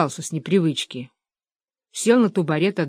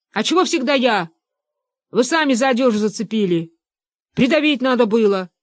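aggrieved protest; 5 semitones higher, 3 dB louder than usual. Acted. Hunters quarrel over the miss.

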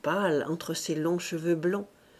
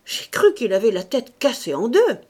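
louder, shaken exclamation; voice full of emotion; 3 semitones higher, 8 dB louder than usual. A narrator's reading. Doctor telling Maffre of the fright, louder and shaken. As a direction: tell it still shaken by the fright. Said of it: "d'une voix pleine d'émotion".

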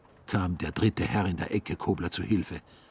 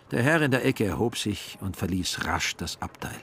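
plain reading voice

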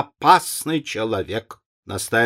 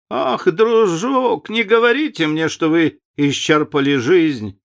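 exclaiming; 6 semitones higher than usual. A narrator's reading. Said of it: "воскликнула леди Нарборо". (высокое, протяжное восклицание)

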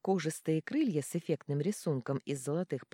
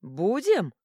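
louder, higher echoed question "Будем"; loud repeat; 4 semitones higher, 8 dB louder than usual. A narrator's reading. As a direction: bark it back at him. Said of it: "переспросил Дробуш". (громкий переспрос)

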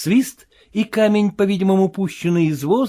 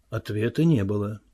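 ordinary delivery, nothing marked

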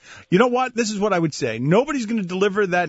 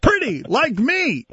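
hammy ad read; 10 semitones higher, slower than usual. Retold or imitated. Mimicking the Nutrisystem ad.

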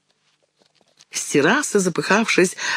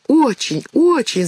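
excited cry; 10 semitones higher than usual. A narrator's reading. Mouse cries out to mother in delight, excited and high. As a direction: gush with delight at mother's look. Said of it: "закричала Мышка".

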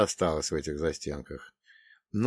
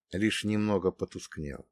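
usual speaking voice

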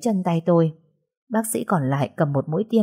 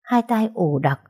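ordinary delivery

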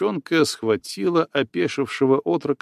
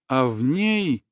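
usual speaking voice

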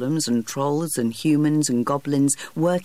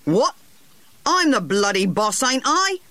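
1 angry retort; 9 semitones higher than usual. A narrator's reading. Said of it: "said Harry, angrily".

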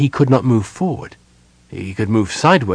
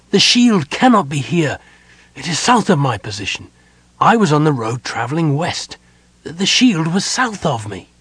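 raised pitch, insistent frustration; frustrated protest; 6 semitones higher, 3 dB louder than usual. A narrator's reading. Sylvan protests the shield's position, frustrated. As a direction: argue against what is in front of you. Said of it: "Sylvan cried in frustration".

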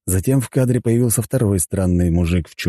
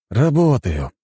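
mildly sighed reply, raised pitch; sighing; 6 semitones higher, slower than usual. A narrator's reading. Man at his desk answering the deity, sighing. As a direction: answer with a touch of a sigh. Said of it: "вздохнул я".

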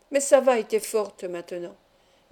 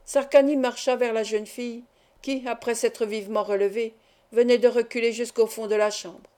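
ordinary delivery, nothing marked

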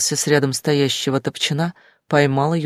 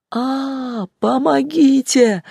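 screaming for help; 10 semitones higher, much slower than usual. A narrator's reading. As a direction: wail it out, terrified. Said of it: "разлетелся гулким эхом её крик".

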